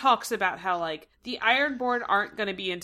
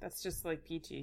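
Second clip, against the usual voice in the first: sarcastic deadpan; 4 semitones lower, 14 dB quieter than usual. Real, not acted.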